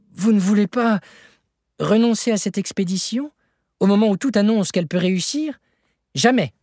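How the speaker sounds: high, agitated exchange; heated outburst. A narrator's reading. Nephew and uncle quarrel over the expedition, heated.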